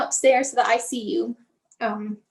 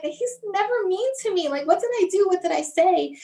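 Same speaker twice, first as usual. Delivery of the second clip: higher-pitched, hurt questioning, no real sobs; retold tearful bewilderment; 6 semitones higher than usual. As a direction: plead to understand what went wrong, voice lifted. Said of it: "I just remember crying".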